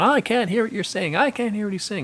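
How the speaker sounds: high, whiny mock complaint; complaining audience. Audience members complaining they can't hear, mock whine. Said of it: "finally the people are going".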